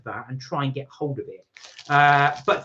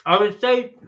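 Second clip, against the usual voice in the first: talking with a mouthful; mouth full; 6 semitones higher, 6 dB louder than usual. Real, not acted. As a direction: speak through a mouth full of biscuit. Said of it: "sorry, I shouldn't talk with my mouth full".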